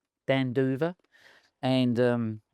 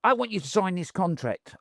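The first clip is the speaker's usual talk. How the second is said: higher-pitched mimicry of a promoter's demand; rough impression, voice pitched up; Don King; 7 semitones higher than usual. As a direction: imitate the promoter pressing for a deal. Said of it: "I can't do his accent".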